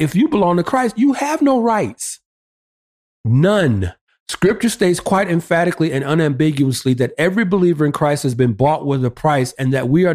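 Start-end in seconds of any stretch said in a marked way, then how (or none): 0.0-3.9 rising, insistent pulpit climax; emphatic preaching. Real, not acted. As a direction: pitch climbing high, slowing onto the last words.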